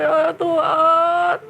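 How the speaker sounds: high, slow crying plea; tearful prayer. Acted. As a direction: beg God for forgiveness in tears. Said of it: "Nangis dia". (doa sambil menangis)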